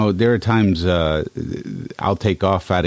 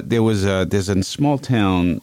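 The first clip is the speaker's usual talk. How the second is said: normal talking voice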